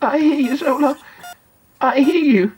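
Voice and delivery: put-on earnest boy making a promise. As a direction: promise your hero with all your heart. Boy silently answers his hero.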